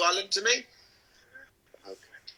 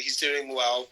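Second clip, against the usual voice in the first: ordinary delivery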